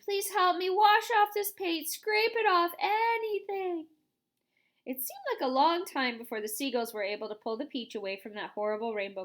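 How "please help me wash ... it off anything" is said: high, loud begging; desperate pleading. A narrator's reading. Centipede begging James to scrape off the paint.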